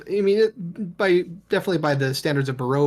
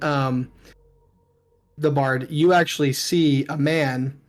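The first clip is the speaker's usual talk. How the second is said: normal talking voice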